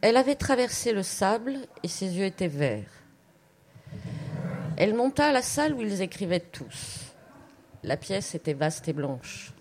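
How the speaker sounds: composed literary reading, pauses between sentences; story narration. A narrator's reading. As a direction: read the opening of a story aloud. Reads another story opening aloud.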